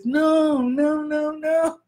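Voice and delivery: a hint of Kevin Hart begging no, no, no; playful comic bit of Kevin Hart protesting; Kevin Hart impression. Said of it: "I'm gonna do my Kevin Hart voice".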